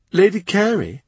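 a candid courtier telling hard truths; lightly voiced, tart lady; blunt lady-in-waiting. Rochford giving orders.

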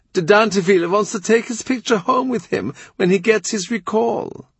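a lightly voiced courtier; Kratzer's voice. A narrator's reading.

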